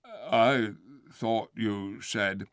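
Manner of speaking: slow, slurring puzzled bear. Acted. The bear puzzling to the ape, slow puzzled voice. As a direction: puzzle it out slowly. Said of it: "slow, puzzled voice".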